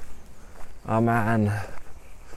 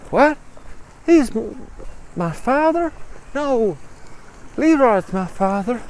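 high, stammering disbelief; shocked disbelief; 14 semitones higher than usual. Acted. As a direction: react as if your world just flipped. Mary shocked by the news.

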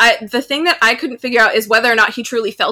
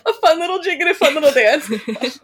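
amused echo; 5 semitones higher than usual. Retold or imitated. Laughing repeat of co-host's phrase.

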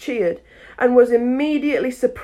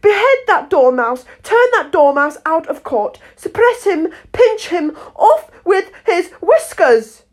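high, loud string of furious orders; shrieking queen; 9 semitones higher, 8 dB louder than usual. A narrator's reading. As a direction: shriek out commands like a raging queen. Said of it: "the queen shrieked out".

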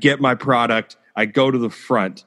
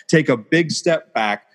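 normal talking voice

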